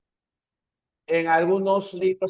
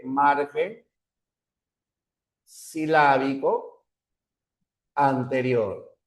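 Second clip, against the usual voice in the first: slow dictation; 3 semitones lower, slower than usual. Shown as a demonstration. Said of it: "vendría a ser lo que les dije".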